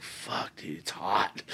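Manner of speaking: breathy near-whisper, hot in the ring; hushed muttering. Retold or imitated. Fighter muttering to himself under the hot lights, hushed.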